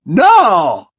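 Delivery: high, loud cry of denial; howling. A narrator's reading. Bellatrix hearing the prophecy is lost, howled. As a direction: howl the word out. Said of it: "aulló ella".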